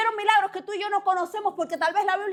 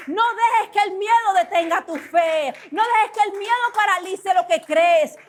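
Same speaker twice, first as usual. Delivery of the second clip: slow, loud preaching shout; urgent charge; 3 semitones higher, 5 dB louder, slower than usual. Acted. Preacher voicing God telling listeners to dream again, slow and loud, building to a shout. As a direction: call them to rise again, building to a shout.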